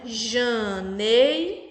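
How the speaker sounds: "Janeiro" slowly split into three syllables. Shown as a demonstration. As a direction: sound out "Janeiro" in three long, separate beats. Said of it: "porque tem três sílabas". ("Janeiro" bem devagar, sílaba por sílaba)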